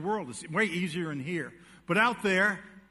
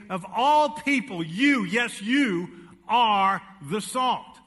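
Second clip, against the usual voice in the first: slow, louder, "all" and "salt" stressed; emphatic reading; 3 semitones higher, 5 dB louder, much slower than usual. Shown as a demonstration. Preacher quoting Jesus to the congregation, slow and emphatic. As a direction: drive each word home slowly. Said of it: "It's emphatic to say".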